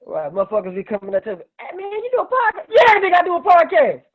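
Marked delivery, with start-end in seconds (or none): 1.6-2.7 high, eager fan asking; mock fan voice. Imitating fans at him. 2.7-4.0 high loud retort; mock shout. His retold loud answer.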